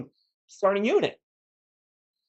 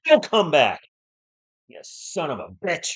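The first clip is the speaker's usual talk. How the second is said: loud, sharp send-off; angry dismissal; 5 dB louder than usual. Real, not acted. Streamer telling a chat troll off, loud and angry. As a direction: kick him out loud.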